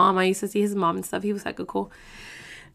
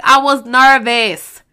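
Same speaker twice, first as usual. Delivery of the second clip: loud, drawn-out retold protest; emphatic defence; 3 semitones higher, 9 dB louder, slower than usual. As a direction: push back loudly, stretching the words. Retold loud defence against accusers.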